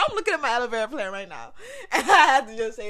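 loud laughing aside. Real, not acted. Speaker laughs looking at her aloe plant, loud laughter. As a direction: crack up mid-sentence.

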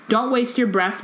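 ordinary delivery

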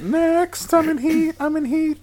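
very high, squeaky cat voice. Retold or imitated. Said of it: "That's my cat voice".

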